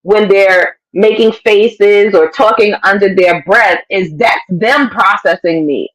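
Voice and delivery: ordinary talk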